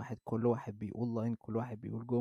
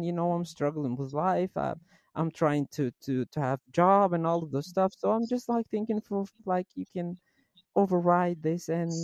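higher, slow pleading caller voice; pleading customer; 7 semitones higher, 5 dB louder, slower than usual. Retold or imitated. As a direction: beg the supervisor for help. Customer pleading her case.

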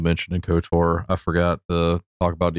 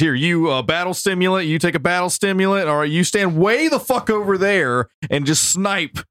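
frantic party orders; 10 semitones higher than usual. Retold or imitated. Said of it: "I got these drugs".

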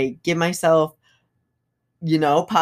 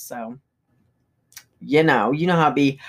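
ordinary talk, nothing marked

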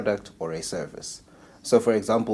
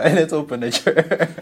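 laughing joke; 8 semitones higher, 5 dB louder than usual. Real, not acted. Joking excited suggestion.

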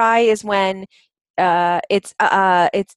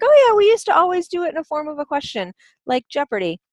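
excited realization; 8 semitones higher than usual. Retold or imitated. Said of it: "And I was like".

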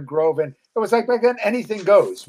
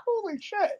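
high-pitched mock panic, but hushed; a retold yelp of alarm; a friend panicking over stolen kegs; freaking out; 12 semitones higher, 9 dB quieter than usual. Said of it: "freaking out".

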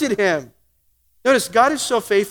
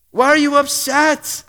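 higher, prodding voice of God; God gently asking; 5 semitones higher than usual. Retold or imitated. God asks the listener, in a higher coaxing voice. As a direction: lift the voice and coax an answer. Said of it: "God is asking you".

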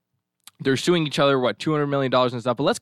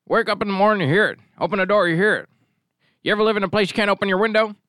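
grumpy man impression; 6 semitones higher, 3 dB louder, faster than usual. Mocking the grumpy man's complaint.

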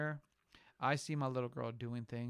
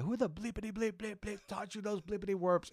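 high-pitched mock angry scolding; retold parental outburst, bleeps for cusses; angry dad; 8 semitones higher than usual. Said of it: "he was very angry".

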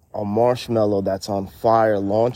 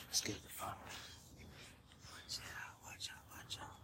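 hushed, tense whisper; breathless scared whisper; 4 semitones lower, 24 dB quieter than usual. Real, not acted. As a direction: whisper like someone might hear. Whispering about scary people.

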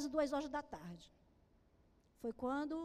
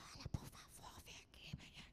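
whispered plea, barely audible; a soft, breathy whisper instead of a real cry; mock timid whisper; 14 dB quieter than usual. Shown as a demonstration. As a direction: whisper the call for help as if afraid to wake him. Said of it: "eu nunca vi ninguém clamar assim".

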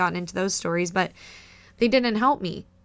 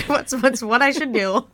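talking through real laughter, higher and louder; laughing; 4 semitones higher, 6 dB louder than usual. Real, not acted. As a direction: let the laugh break into the words. Laughing over odd mid-podcast interruption.

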